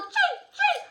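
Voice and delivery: hammed-up swordfight; swordfight clangs. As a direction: shout the fight sounds. Acting out sword fight.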